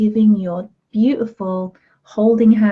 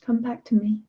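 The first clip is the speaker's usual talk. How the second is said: tender inner voice; 2 semitones higher, 5 dB quieter than usual. Pretend. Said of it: "put your hands there like".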